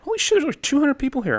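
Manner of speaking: high, quick burst of disbelief; amazed, pitch leaping up; real astonishment. Real, not acted. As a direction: blurt it out, stunned by the turnout. Reacts to unexpected viewer count.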